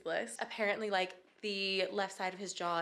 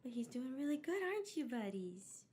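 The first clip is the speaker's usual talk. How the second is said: pet talk; 5 semitones higher than usual. Real, not acted. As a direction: coo to your cat. Talking to the cat.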